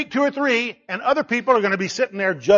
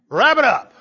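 loud, raised call; short heckle, pitched up; mock calling out; 4 semitones higher, 6 dB louder than usual. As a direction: call it out to hurry a speaker. Repeats the call to wrap up.

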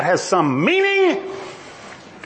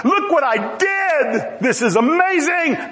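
high, amazed boast with stretched words; enthusiastic God; 7 semitones higher, slower than usual. Acted. God showing off his creation, high and enthusiastic. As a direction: proudly exclaim over your own handiwork. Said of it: "share his enthusiasm".

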